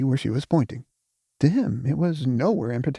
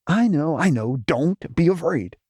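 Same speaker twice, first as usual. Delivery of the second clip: louder mocking reassurance; sarcastic, talking over her; 4 semitones higher, 3 dB louder than usual. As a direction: sneer it as fake comfort. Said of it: "interrupted Smudge sarcastically".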